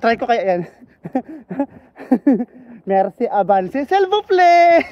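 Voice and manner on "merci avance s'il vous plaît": slow, loud Ilonggo-French request; Ilonggo-accented French. Retold or imitated. Mimicking a cashier in Ilonggo-accented French. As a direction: stretch the French out with Ilonggo sounds. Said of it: "tapos Ilonggo version".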